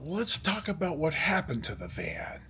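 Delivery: soft whisper to himself; whispered thought. Retold or imitated. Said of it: "I thought".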